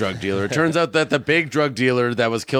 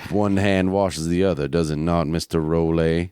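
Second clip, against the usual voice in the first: put-on Southern Atlanta accent, lower; 5 semitones lower than usual. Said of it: "I talk in this Atlanta accent now".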